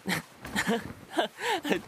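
high giggling laugh; ticklish giggle. Acted. Laughing while partner touches belly.

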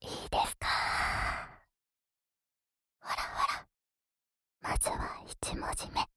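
breathy whisper into the ear. Acted. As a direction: whisper it playfully, close up.

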